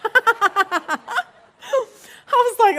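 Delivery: real laughter breaking through; cracking up at the story; laughing. Real, not acted. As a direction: laugh high and loud through the words. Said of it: "This made me laugh".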